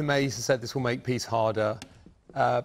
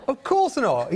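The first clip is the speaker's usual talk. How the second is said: emphatic echo; 11 semitones higher, 5 dB louder, slower than usual. Real, not acted. Echoing guest's dismissal emphatically.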